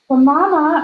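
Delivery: a high, loud gasped protest; gasped plea. A narrator's reading. Boy protesting to Mama about losing the dog, gasped. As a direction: blurt it out in alarm. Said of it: "I gasped".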